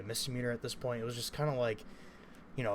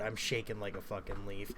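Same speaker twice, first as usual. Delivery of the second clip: normal talking voice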